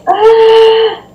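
sudden high yelp of fright; startled yelp. Real, not acted. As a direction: yelp in alarm. Creeper spotted in the water.

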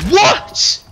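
high, loud "what"; shocked, high-pitched exclamation; incredulous outburst. Real, not acted. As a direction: yelp it in disbelief. Sees lag after denials.

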